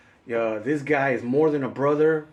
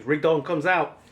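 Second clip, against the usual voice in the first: ordinary talk, nothing marked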